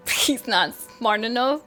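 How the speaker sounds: ordinary talk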